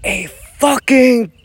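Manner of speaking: high, slow, drawn-out mock shout; faux yell. Rant about breaking silence, slow faux yell. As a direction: stretch it out like a yell into a bullhorn. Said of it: "faux yell".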